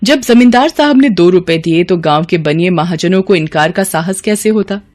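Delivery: ordinary delivery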